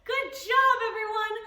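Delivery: loud, high, bubbly cheer; big cheer for the class; excited praise. Real, not acted. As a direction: hype the little ones up. Praising kids after launch.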